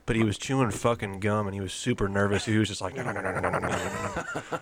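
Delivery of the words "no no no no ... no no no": jittery cokehead. Retold or imitated. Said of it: "like a fucking cokehead".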